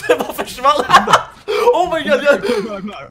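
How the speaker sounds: high, loud laughing outburst; helpless laughter. Real, not acted. Player cracking up at something vanishing in game, laughing hard. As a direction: shriek it through uncontrolled laughter.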